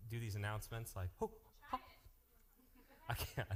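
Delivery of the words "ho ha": high, put-on announcer grunt; copying that guy's voice. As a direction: mimic that guy's booming "Ho, ha". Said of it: "I wish I had that guy's voice".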